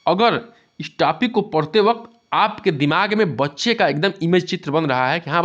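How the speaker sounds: ordinary talk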